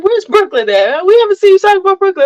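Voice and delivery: high-pitched mock questioning; imitated curious viewers. Imitating viewers asking after her missing child, high mock voice. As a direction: put on a high voice for nosy viewers.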